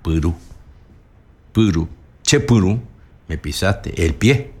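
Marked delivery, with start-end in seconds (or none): 0.0-2.8 "puru" as pyru, first vowel central and unrounded; "che puru" with tight y before the final u; Guaraní y vowel. Shown as a demonstration. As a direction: keep lips unrounded on the first syllable of "puru". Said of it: "no digo pu".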